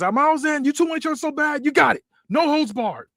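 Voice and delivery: high, bright-eyed announcer of a grudge match; a hyped-up general manager, pitched high; excited boss voice. Acted. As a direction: sell the stipulation like a showman. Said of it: "he says".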